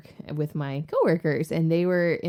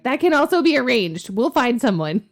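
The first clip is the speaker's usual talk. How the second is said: eager coworkers; 7 semitones higher, 7 dB louder than usual. Retold or imitated.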